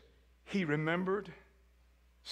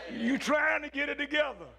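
laughing; 7 semitones higher than usual. Real, not acted. Still laughing at the memory.

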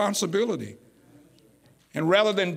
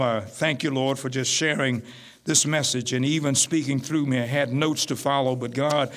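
lower, steady prayer voice; closing prayer; 4 semitones lower than usual. Acted. Pastor praying over the congregation, lower and calm. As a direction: speak to God plainly and humbly.

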